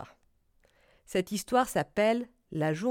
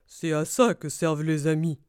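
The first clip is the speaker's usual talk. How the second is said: lower-pitched smiling badger; Blaireau; 5 semitones lower, 4 dB louder than usual. A narrator's reading. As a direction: reassure a friend in a low character voice. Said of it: "répondit Blaireau en souriant". (voix grave de blaireau, en souriant)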